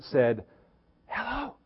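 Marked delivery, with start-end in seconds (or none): 1.1-1.5 put-on high, soft little four-year-old voice; small child's voice. Pastor voicing little Jimmy answering the telemarketer, high and soft. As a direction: answer the phone like a tiny shy kid. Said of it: "with his four-year-old small voice".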